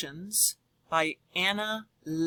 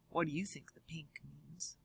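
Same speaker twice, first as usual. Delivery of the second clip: whispered question; 3 semitones lower, 14 dB quieter than usual. Real, not acted. Teacher asks class.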